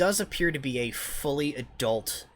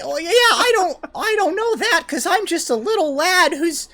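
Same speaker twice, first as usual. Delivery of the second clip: put-on very high, loud kid voice with a laugh; fake little boy voice; 12 dB louder than usual. Gnome keeps pretending to the party in a very high boy voice. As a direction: keep up the innocent child act. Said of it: "he's like".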